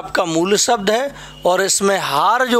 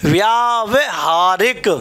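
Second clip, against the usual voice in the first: slow spelling-out; slower than usual. Shown as a demonstration. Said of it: "व्यावहारिक लिखते हैं".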